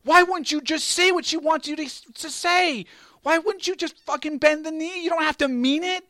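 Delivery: high, loud exasperated yell at a character. Retold or imitated. Fan yelling at Jon Snow on TV, high and loud. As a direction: shout at the screen as if he could hear you. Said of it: "you're yelling at the T V".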